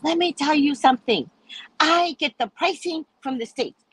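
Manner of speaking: high-pitched mock insistence; imitated shopkeeper setting someone straight; insistent retort. Retold shop man arguing over prices.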